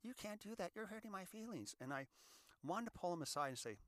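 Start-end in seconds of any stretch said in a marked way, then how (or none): 0.0-1.7 high, rising, upset mock squeal; flipped-out bartender. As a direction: imitate a young guy freaking out over being called sir. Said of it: "he kind of just flipped out".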